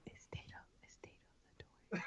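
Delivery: hushed whisper to a teammate. Real, not acted. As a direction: secretly whisper the question. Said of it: "We can hear you whispering".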